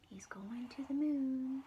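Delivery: plain reading voice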